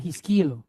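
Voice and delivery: Hiskilu with the weight on the middle syllable KI, not on the end; stress on the middle. Shown as a demonstration. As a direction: lean on the KI in Hiskilu. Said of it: "stress the word in the middle, not at the end".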